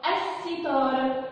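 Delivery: "الستارة" drawn out with no l sound; silent lam. Shown as a demonstration. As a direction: say "الستارة" slowly as as-sitara, holding the s. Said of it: "سمعنا صوت اللام". ("الستارة" على مهل من غير صوت اللام)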